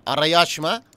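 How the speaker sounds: loud farewell call after a customer. Acted. Cafe owner seeing a regular customer off, calling out. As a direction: send them off with a loud call.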